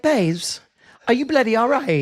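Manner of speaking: hammed-up English accent, rising high; put-on English accent, on the edge of laughing. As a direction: imitate an Englishwoman with cheeky exaggeration. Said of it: "accents like that are offensive".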